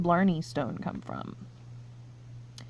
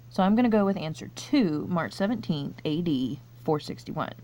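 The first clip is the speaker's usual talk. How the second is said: ordinary talk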